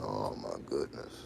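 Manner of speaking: low, slow mock dismay. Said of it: "I'm like".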